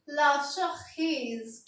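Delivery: ला शखीज drawn out very slowly; slow French pronunciation. Shown as a demonstration. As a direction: say ला शखीज slowly for students to copy. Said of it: "तो हम बोलेंगे".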